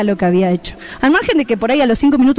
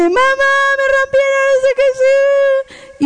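put-on high, slow whining child's voice, much higher; little son tattling to mom about his broken Legos, high and whiny; whiny little boy; 12 semitones higher, much slower than usual.

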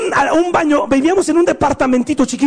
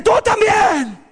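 high shouted accusation; mom yelling; 9 semitones higher, slower than usual. Retold or imitated. Furious mother turns on the other son, high shout. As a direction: snap it at the second kid.

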